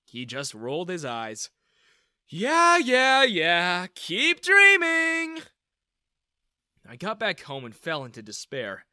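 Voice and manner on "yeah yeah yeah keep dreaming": loud, high, drawn-out mockery; scornful, stretched-out taunt; jeering. A narrator's reading. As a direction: call it out in a sing-song dismissal. He rolls his eyes, jeering.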